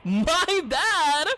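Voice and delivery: high, slow mock-meek apology; sheepish apology. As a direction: squeak out a small, embarrassed sorry. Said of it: "And I'm like".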